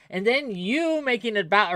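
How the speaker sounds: ordinary talk, nothing marked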